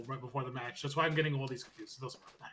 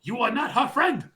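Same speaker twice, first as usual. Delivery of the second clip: mock confrontation; 10 semitones higher, 9 dB louder than usual. Asuka confronting Rhea, acted.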